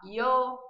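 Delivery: "yo" said as "io", with a vowel glide; i sound. Shown as a demonstration. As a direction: open "yo" with an I sound. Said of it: "Ele também pode ser I".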